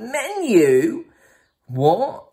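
high rising yelp of disbelief; incredulous. Retold or imitated. Mate baffled by meal-kit menu, high incredulous query. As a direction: shoot the pitch up on the question.

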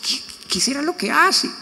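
high, mock puzzled voice; puzzled neighbours. Neighbours wondering what he does abroad, in a high mock voice. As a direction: wonder aloud like the gossiping neighbours. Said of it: "Entonces ellos dicen".

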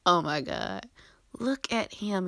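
breathless whisper of adoration; hushed, starstruck whisper; awed whisper. Real, not acted. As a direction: whisper it like you can't believe your eyes. Fangirling over his photo.

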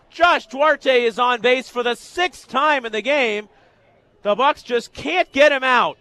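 high, loud baseball call; thrilled sportscaster at full voice; excited play-by-play. Real, not acted. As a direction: burst out with the go-ahead run. Calls the go-ahead hit live.

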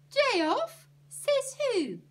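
very high, loud, incredulous challenge; affronted shouted question; indignant outburst. A narrator's reading. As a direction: throw it back in outraged disbelief. Ernie challenges Annie's announcement.

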